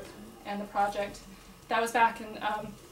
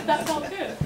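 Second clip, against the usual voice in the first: high, delighted laugh into the words; laugh, then talk through it; 6 semitones higher, 6 dB louder than usual. Real, not acted. Coordinator to a cheering audience member, laughing. Amused thanks after a cheer.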